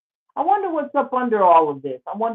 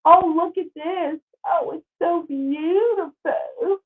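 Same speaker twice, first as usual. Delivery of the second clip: high, slow, swooning over-the-top praise; mock gushing; 10 semitones higher, slower than usual. Vlogger touring the plantation, swooning in mock awe. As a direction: gush as if moved to tears, with a wink.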